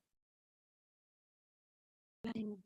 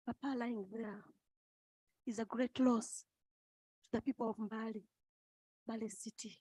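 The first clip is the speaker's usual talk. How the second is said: ordinary talk, nothing marked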